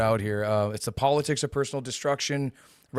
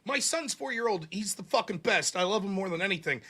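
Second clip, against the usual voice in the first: mock doting parent; 8 semitones higher, 4 dB quieter than usual. Voicing an imagined proud parent.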